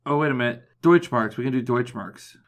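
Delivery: ordinary talk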